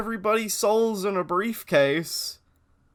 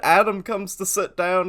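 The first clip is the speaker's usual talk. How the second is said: usual speaking voice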